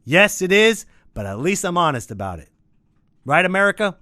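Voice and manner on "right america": raised, rhetorical appeal to a crowd; calling out. Pretend. Ranter appeals to America, calling out. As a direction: call out to the country, higher pitched.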